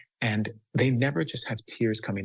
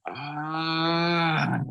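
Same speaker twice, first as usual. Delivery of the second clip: angry sound; 6 semitones higher than usual. Shown as a demonstration. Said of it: "How does that anger sound?".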